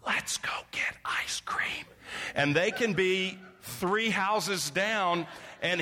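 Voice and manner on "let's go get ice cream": hushed, voiceless whisper; fully whispered, no voice at all. Shown as a demonstration. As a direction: whisper it like a tempting secret to the kids. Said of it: "And yet you can whisper".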